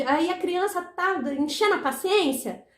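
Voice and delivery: ordinary talk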